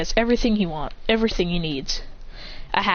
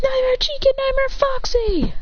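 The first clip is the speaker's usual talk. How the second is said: anguished call. Acted. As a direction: cry out for fallen allies.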